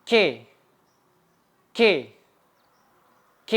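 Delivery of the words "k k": ordinary talk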